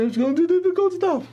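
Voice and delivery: high, pleading whine as a desperate student; whiny pleading student. Retold or imitated. Student begging his teacher in a high pleading whine. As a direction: beg the master in a thin high voice.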